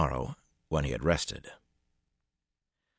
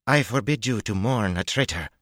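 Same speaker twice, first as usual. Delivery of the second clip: firm command; 6 semitones higher, 5 dB louder than usual. A narrator's reading. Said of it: "Her voice softened slightly".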